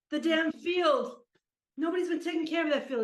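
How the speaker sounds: loud, high, indignant outburst; worked-up complaint. Acted. Old player laments the neglected field, worked-up complaint. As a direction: build into a grumbling rant.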